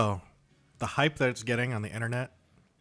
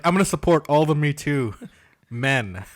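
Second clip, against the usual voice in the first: mocking proud declaration; 4 semitones higher, 8 dB louder than usual. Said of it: "you're like".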